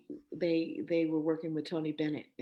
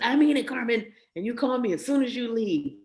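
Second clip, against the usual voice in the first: stern dad; 6 semitones higher, 8 dB louder than usual. Retold or imitated. Dad insisting on phone.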